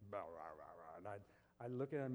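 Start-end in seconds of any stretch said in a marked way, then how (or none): 0.1-1.0 low, soft grumbling noises; low mock grumble; old man grumbling. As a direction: grumble under the breath like a grouchy old man. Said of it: "I'd hear him grumbling".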